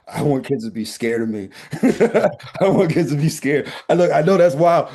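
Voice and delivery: laughing through the line; a real laugh breaking the words. Real, not acted. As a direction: giggle as you admit it. Laughing at own wild claim.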